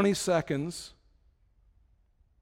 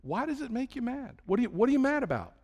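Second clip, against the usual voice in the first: gently questioning God; 6 semitones higher than usual. Acted. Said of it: "I can just hear God say".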